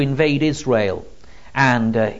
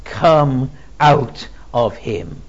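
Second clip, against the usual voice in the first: very slow, weighty command; slow measured exorcism command; 2 semitones lower, much slower than usual. Acted. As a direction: drive the demon out word by word. Jesus commands the demon.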